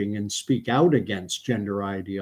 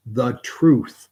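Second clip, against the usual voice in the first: "truth" hit louder, set apart; stress on truth; 3 semitones higher, 7 dB louder than usual. Real, not acted. Quoting Jesus's words to viewers, "truth" stressed. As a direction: lean hard on "truth". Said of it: "I'm stressing the word, the truth".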